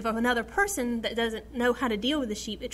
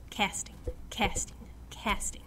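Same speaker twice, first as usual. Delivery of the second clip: whispered repetition; 6 dB quieter than usual. Retold or imitated. Voicing her inner struggle.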